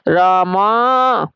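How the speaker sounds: long drawn-out call, vowel stretched; calling from afar. Shown as a demonstration. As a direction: call it out as if across a field. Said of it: "उसे दूर से पुकारने के लिए हम लगाते हैं आवाज". (दूर से पुकारती लंबी आवाज़)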